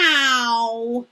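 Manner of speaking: loud stretched meow; big cat meow. Shown as a demonstration. Teacher plays a cat for pupils, big meow. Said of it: "I want you to be a cat".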